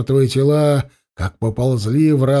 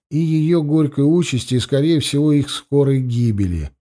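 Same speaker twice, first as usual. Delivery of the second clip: ordinary delivery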